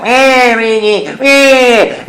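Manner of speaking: a taunting Waluigi drawing out his words. Acted. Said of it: "there's Waluigi".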